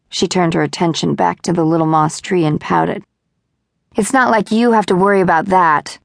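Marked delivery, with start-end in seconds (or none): none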